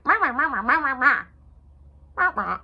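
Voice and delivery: a nagging meh meh, hammed up; mocking a complaining spouse as a Muppet; Beaker from the Muppets. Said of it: "complain like Beaker off of the Muppets".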